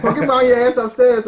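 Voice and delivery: parent yelling. Retold or imitated. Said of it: "my parents had said some crazy ass shit".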